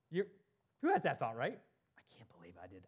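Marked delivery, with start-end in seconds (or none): 2.0-2.8 hushed, low whisper of disbelief; whispered self-reproach. Acted. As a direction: whisper it to yourself as a guilty thought. Acts out thought after a slip.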